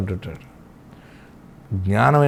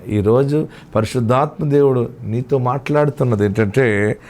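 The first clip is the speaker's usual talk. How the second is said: ordinary talk, nothing marked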